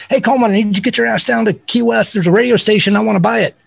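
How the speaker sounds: imitated singer giving a casual order. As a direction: pitch it up, issue a breezy summons. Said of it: "[name] calls him up one day and says".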